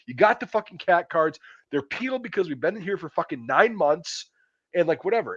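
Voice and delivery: retold angry yell. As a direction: blow up at the gate guard. Driver retold yelling at gate guard.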